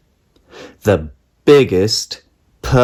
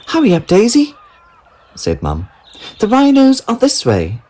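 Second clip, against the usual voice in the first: high, loud urging mother; hurrying mum; 13 semitones higher, 3 dB louder than usual. A narrator's reading. Mum hurrying Daisy at the zoo, high and loud. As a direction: call her along like a busy mum.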